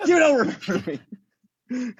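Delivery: high, laughing "shut up"; joking shout. Retold or imitated. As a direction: yell it playfully through laughs. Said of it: "I'm like".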